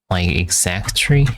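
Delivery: normal talking voice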